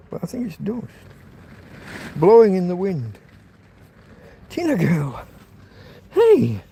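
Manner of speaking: showing his dog to the viewers, cooing; dog talk. Real, not acted. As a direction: coo at the dog.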